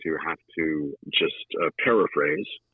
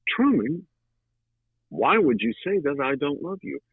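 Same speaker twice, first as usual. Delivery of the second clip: pleading Big Mama; 8 semitones higher than usual. Retold or imitated. Big Mama pleading with Truman, voice pitched up high.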